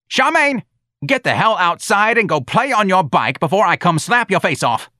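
high, loud hollered threat; screamed scolding. Acted. Santa yelling at Charmaine mid-call, furious. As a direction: call the child sharply, then rant. Santa yells at grandchild again.

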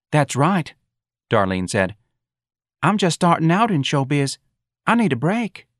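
high, pleading character voice; eager young performer. Acted. Darlene asking for a break in showbiz, eager. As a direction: pipe up eagerly, pitched high.

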